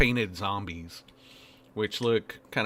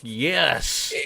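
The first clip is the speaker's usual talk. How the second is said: excited shout; 4 semitones higher, 5 dB louder than usual. Real, not acted. Excited cry at the game.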